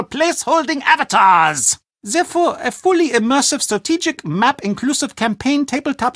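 proud German game maker, precise and a little pompous; German accent. Acted. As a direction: lecture the American about board games with German pride. German board game designer speaks.

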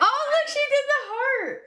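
delighted, high and bright squeal of joy; excited. Real, not acted. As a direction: burst out thrilled at the heart. Sees the heart reaction.

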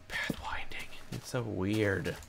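breathy whisper, slow on the second line; whispered aside. Real, not acted. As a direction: whisper it to yourself. Muttering to himself.

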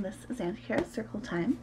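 usual speaking voice

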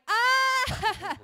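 loud high mock scream. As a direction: scream on entering the gym as a power move. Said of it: "I'll just start screaming immediately".

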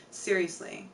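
usual speaking voice

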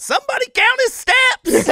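high shouted heckle, laughing; heckling shout. Retold or imitated. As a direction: yell it from the sidelines. Dale heckling from the background.

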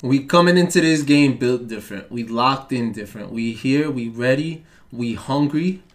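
ordinary delivery

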